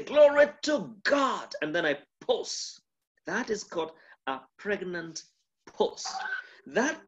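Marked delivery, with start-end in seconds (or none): 0.0-1.5 high, loud and slow "Glory to God"; raised glory. Shown as a demonstration. Preacher demonstrating a raised glory before a pause. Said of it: "When I speak and say".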